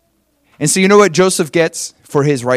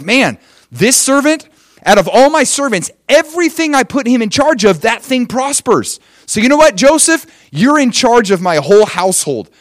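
higher-pitched, marveling slave master; impressed master; 7 semitones higher, 3 dB louder than usual. Acted. Potiphar putting Joseph in charge of his household, impressed. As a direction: marvel aloud, stretching key phrases high. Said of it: "Potiphar's like".

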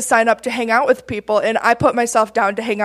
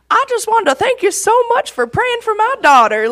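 high, quavering imitation of an old man; little old man; 10 semitones higher than usual. Grateful dad to the woman praying for his daughter, high imitated old-man voice. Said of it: "He was this little old man".

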